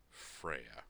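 low, grim; dark realization. Acted. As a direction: say the name darkly. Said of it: "his face darkening".

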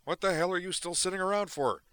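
demanding. Acted. Duke demands why Zoner is still sitting, loud and exasperated. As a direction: bark the question at him. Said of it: "Duke demanded".

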